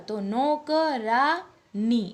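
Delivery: નોકરાની drawn out, stressing આની; slow teaching demo. Shown as a demonstration. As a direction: sound out નોકરાની slowly. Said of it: "આનો જ્યારે ઉચ્ચાર થાય છે".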